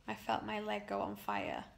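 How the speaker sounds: usual speaking voice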